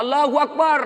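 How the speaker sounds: high, loud shouted takbir; mock cry from the back row; imitated youth's yell. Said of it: "Tiba-tiba berteriak anak muda satu di belakang".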